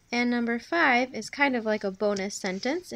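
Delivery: ordinary talk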